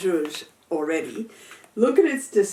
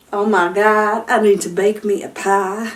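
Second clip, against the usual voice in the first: a louder, badly faked Southern accent; 5 dB louder than usual. Vlogger jokingly tries a Southern accent, badly faked Southern accent. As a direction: ham up a clumsy Southern accent, half-joking. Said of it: "the worst Southern accent I've ever heard".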